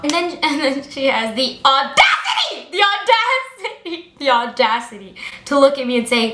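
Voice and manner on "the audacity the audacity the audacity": indignant disbelief. Real, not acted. Indignant at teacher's question.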